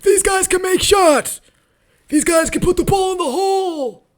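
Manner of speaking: mocking coach. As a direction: ham up a chirpy helpless coach. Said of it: "what were you saying in the huddle".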